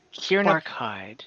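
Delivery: ordinary talk, nothing marked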